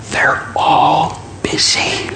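put-on slow, soft child's whisper; small whisper. Child putting off the telemarketer, whispering. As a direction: whisper it word by word, like a kid. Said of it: "whispered Jimmy".

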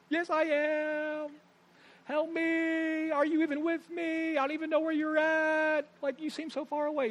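slow, high, drawn-out plea to God; pleading prayer. Retold or imitated. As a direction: stretch it out like a lost soul. Imitating needy prayer.

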